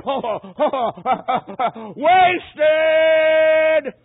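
high, loud cry rising to a scream on wasted; retold scream of a dying man's regret; anguished scream. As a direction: throw wasted out at the top of the voice. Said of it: "he just screamed out".

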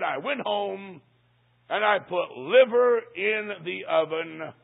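slow, weighty build toward "liver"; slow dramatic build. Retold or imitated. Mother revealing to the preacher what she cooked, slow dramatic build. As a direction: draw it out, saving "liver" for the punch. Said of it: "At noon today," she said".

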